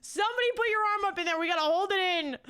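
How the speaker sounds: rally the team like a coach; hammed-up battle cry; mock coach yell. Mock defense coach yelling.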